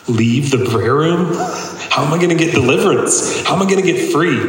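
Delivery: incredulous laughing disbelief. Retold or imitated. Incredulous at God's instruction.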